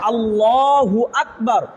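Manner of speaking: slow আল্লাহু আকবার with a heavy, full l in আল্লাহু; drawn-out আল্লাহু with a thick, dark l; slow, careful আল্লাহু আকবার, heavy l; correct takbir. Shown as a demonstration. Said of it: "কি হবে?".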